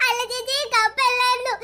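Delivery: normal talking voice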